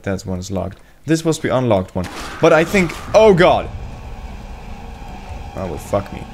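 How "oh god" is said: high, loud exclamation; startled cry. Real, not acted. Gamer caught off guard in the game, startled cry. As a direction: yelp it as if ambushed.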